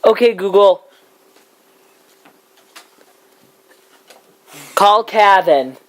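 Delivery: repeating to voice assistant. Real, not acted. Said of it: "who do you wanna call?".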